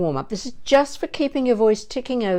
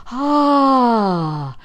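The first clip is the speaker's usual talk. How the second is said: higher, louder open sighing ah of joy; 5 semitones higher, 7 dB louder than usual. Shown as a demonstration. Teacher imagining Spielberg's contract, joyful sighing ah. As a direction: sigh out a delighted ah. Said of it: "the sighing ah".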